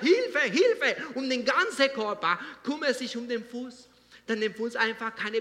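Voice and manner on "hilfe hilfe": high, loud call of Hilfe; mock cry for help. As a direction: shout it like a mouth in pain. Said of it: "dein Mund schreit und sagt".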